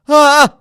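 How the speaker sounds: short, loud, high yelp; a sudden mock cry of fright at a jolt; startled whimper. Podcaster imitating passenger during turbulence aftershock, frightened whimper. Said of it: "he would whimper".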